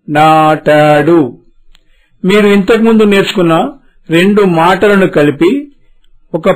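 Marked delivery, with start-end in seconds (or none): 0.1-1.2 నాటాడు stretched out very slowly, rising at the end; blended model word. Shown as a demonstration. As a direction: join the syllables నా టా డు slowly. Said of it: "మళ్ళీ చెప్పండి".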